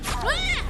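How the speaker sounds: sharp high scream of fright; startled yelp. Real, not acted. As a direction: jump and yelp in shock. Sudden scare while fleeing.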